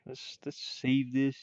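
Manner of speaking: usual speaking voice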